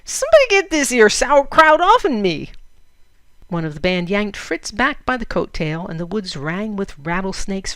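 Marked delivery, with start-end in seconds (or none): 0.0-2.5 high, loud laughing cowboy holler; cowboy yell. Acted. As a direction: holler for help while laughing. Outlaw yelling while attacked.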